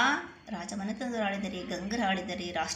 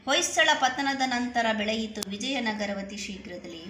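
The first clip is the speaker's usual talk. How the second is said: louder, rhythmic verse reading; poem recitation; 3 semitones higher, 6 dB louder than usual. Acted. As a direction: proclaim the line to the class. Re-reads a poem line before explaining.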